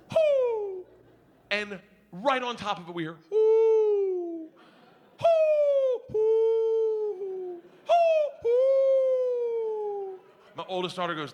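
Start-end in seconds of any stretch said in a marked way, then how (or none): 0.1-0.8 high loud hoot; owl hoot. Retold or imitated. Dad hooting to get his owl going, high loud hoot. 3.3-10.2 high loud repeated hoots. Retold or imitated. The owl hooting back at the dock, loud high hoots.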